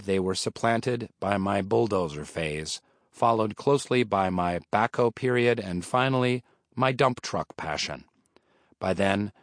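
usual speaking voice

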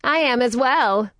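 high, loud, slow eager interruption; enthusiastic. Acted. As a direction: cut in with cheerful rudeness. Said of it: "interrupted her with the customary enthusiastic rudeness".

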